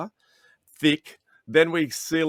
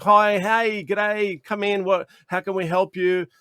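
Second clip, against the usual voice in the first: cheerful greeting; 6 semitones higher than usual. Retold or imitated. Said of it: "all my team's like".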